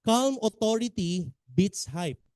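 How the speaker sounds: "calm authority beats hype" said very slowly, each word weighted; slow emphatic motto. Shown as a demonstration. Coach repeats his motto to seminar audience, slow and weighted.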